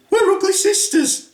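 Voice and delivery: high squeaky ugly sister voice. Acted. Ugly sisters greeting the prince in squeaky voices. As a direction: squeal it like a panto dame.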